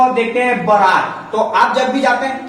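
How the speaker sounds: ordinary talk, nothing marked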